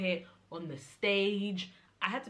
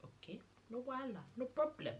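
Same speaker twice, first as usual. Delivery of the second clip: higher, airy mock-calm; lightly voiced brushing-off calm; forced calm; 6 semitones higher, 6 dB quieter than usual. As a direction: keep it light and breezy, holding it together. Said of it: "I was still calm".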